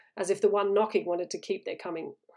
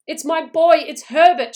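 high, loud frantic insistence; desperate cry; 8 semitones higher, 12 dB louder than usual. A narrator's reading. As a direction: cry out to be believed. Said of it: "she cried".

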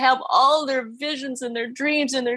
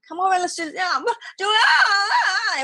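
put-on whine-crying toddler; 10 semitones higher, faster than usual. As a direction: imitate a little girl fussing and crying. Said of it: "she whined slash cried".

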